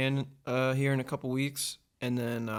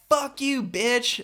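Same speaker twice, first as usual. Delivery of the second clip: mock road-rage yell; 12 semitones higher, 7 dB louder, much slower than usual. Imitating girl yelling from car.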